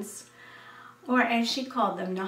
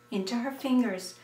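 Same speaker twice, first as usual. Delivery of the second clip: ordinary delivery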